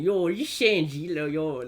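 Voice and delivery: slow, forced Toronto slang; mock a try-hard Toronto voice; mocking Toronto accent.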